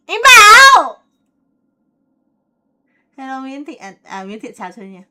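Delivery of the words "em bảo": much higher, loud squealed name; excited squeal. Real, not acted.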